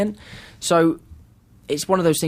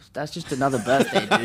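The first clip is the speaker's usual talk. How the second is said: mock dismissive. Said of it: "everyone back home was like".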